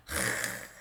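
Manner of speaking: R as a long rough fricative, no vowel; sustained raspy sound for R; letter sound of R. Shown as a demonstration. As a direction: sound out R as a drawn-out noise, not its letter name. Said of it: "Qual é o som da letra R?".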